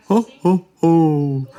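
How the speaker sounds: high, loud ho ho ho; belly out a jolly Santa call; jolly Santa laugh. Acted. Santa greeting Hoppy with a loud jolly call. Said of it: "called Santa".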